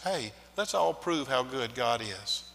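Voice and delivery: ordinary talk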